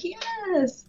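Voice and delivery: high, drawn-out delighted yes; eager yes. Retold or imitated. Friends answering an offer of homemade Pop-Tarts, eager and imitated. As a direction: squeal it keenly, imitating thrilled friends. Acting out friends' keen reply.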